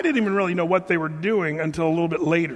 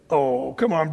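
ordinary talk

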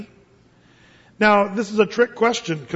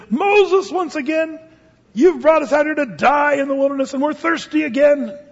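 high, loud whining gripe; grumbling people confront Moses, loud high gripe; griping complaint; 7 semitones higher, 3 dB louder than usual. Retold or imitated. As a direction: nag Moses like fed-up complainers. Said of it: "still murmuring, griping, grumbling".

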